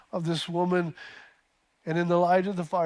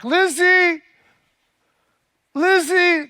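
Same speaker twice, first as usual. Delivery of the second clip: loud calls into the dark, high and long; yelling a name; 11 semitones higher, 8 dB louder, much slower than usual. Acted. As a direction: call out her daughter's name into the night. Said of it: "I'll just yell out into the dark".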